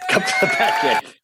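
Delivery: put-on superhero voice, short and direct; Batman. Shown as a demonstration. Actor plays the superhero for the host, put-on voice. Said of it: "but as Batman".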